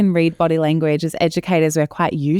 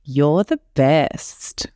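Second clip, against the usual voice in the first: slow, lower, dry sarcasm; sarcastic; 3 semitones lower, much slower than usual. Shown as a demonstration. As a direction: drag it out like you don't mean it. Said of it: "in a sarcastic way?".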